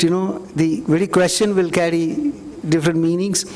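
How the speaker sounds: usual speaking voice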